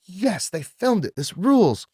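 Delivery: slow, high-pitched mock elation; elated self-quote. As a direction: draw out the joy in a high voice. Retold elated reaction to footage.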